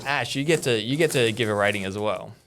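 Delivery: ordinary delivery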